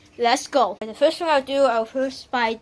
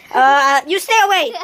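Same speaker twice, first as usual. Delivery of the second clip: loud warning; 8 dB louder than usual. Real, not acted. Warning kid off loudly.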